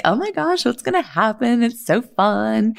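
high giddy mock excitement; excited squeal. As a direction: squeal like a thrilled girl before class. Nervous excited retreat group.

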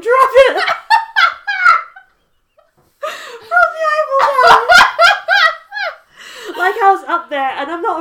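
real laughter, pitch jumping up and loud; helpless, tearful laughter breaking through; laughing hard. Real, not acted. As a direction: crack up while telling it.

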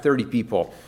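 ordinary delivery

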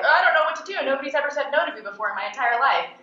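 mock a spoiled girl falling apart; mocking whiny girl. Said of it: "And she's like".

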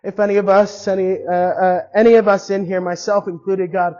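earnest, halting spoken prayer; closing prayer. Acted. Pastor closing the service in prayer for the congregation, earnest. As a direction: pray it sincerely, pausing between phrases.

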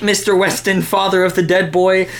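ordinary delivery

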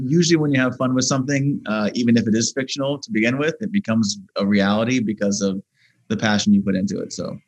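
ordinary talk

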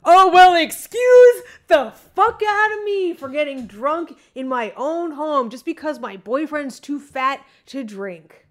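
loud, high, drawn-out indignation; indignant outburst. Real, not acted. Woman snapping at boyfriend who called her drunk, indignant. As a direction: blast back at the accusation, stretching the words.

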